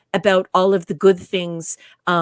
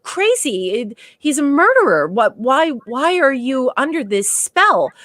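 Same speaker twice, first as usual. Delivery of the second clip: appalled, higher-pitched incredulous protest; Spock grossed out; 6 semitones higher than usual. Retold or imitated. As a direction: pile up the accusations in mock disbelief. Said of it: "Spock is grossed out. He's like".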